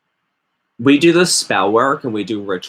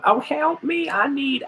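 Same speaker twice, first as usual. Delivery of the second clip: much higher, whiny cry for help; mock desperate plea. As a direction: squeak it like a helpless beginner begging. Mocking Facebook plea.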